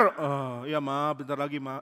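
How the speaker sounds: lightly voiced drowsy mumbler; a hint of sleep-talk; sleepy sleeper. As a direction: answer as if half asleep. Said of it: "Abner masih bobo".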